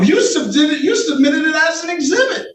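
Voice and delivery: incredulous. Real, not acted. Judge incredulous at submitted plate.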